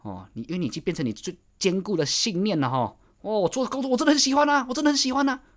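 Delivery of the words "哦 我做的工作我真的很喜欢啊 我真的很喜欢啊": much higher, sing-song mock cheer; mock self-deception. Mocking a self-deceiving worker who claims to love his job, high and sing-song. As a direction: parody someone fooling themselves about their job.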